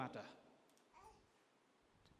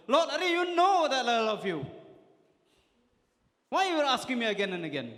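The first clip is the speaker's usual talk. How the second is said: harsh, higher, louder and fast; harsh and severe; 7 semitones higher, 9 dB louder, faster than usual. Acted. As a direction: snap back, fed up at being asked. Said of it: "Maybe he could have spoken in a harsher tone".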